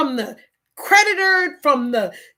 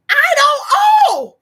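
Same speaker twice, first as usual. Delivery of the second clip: sudden shout on the last words; 11 semitones higher, 5 dB louder than usual. Real, not acted. Garnished for a debt she doesn't owe, she shouts. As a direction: blurt it out at full volume. Outburst over unfair garnishing.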